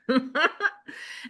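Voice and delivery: light laugh. Real, not acted. Laughs about Rob.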